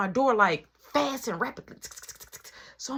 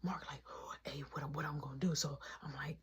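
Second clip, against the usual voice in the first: retold in a low, very soft whisper; sneaky, half-whispered back-and-forth; hushed plotting; 7 semitones lower, 16 dB quieter, faster than usual. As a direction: keep it down so nobody catches you. Retelling sneaky plotting at night.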